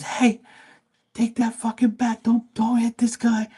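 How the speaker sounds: the boss yelling to stop the fight; panicked boss. Retold or imitated.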